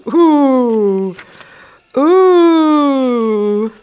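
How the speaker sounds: loud, rising mock-impressed "Ooh"; drawn-out teasing ooh. Real, not acted. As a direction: tease your younger self. Reacting to own old writing.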